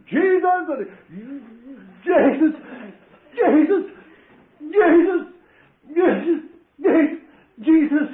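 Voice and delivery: repeated high, loud exultant cries; shouting Jesus. Retold or imitated. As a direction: shout the name again and again in joy. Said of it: "And I shouted".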